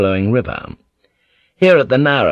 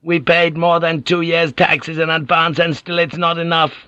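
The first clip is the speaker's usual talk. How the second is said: high anxious villager voice; high-pitched Ninjin fretting about winter; worried fisherman; 8 semitones higher than usual. Acted. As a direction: fret in the high whining voice. Ninjin complains.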